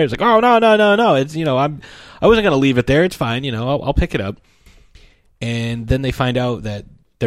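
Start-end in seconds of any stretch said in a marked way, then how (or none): 0.2-4.4 high, louder, hurried excuses that trail off; flustered denial. Retold or imitated. Calvin caught littering, flustered high denial. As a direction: squirm out of blame like a caught kid.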